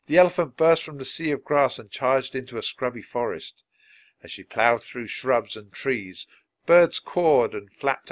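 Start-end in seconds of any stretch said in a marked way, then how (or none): none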